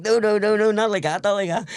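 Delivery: high, loud mock protest; imitating the governor. Governor correcting the speaker, high mock insistence.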